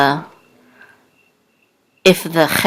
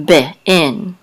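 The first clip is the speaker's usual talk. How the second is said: usual speaking voice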